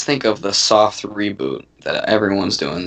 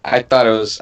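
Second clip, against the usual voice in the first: normal talking voice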